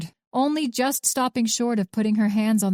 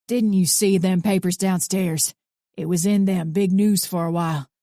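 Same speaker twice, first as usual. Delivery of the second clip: put-on gruff old man. A narrator's reading. Arthur's voice.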